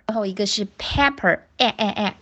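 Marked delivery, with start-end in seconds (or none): none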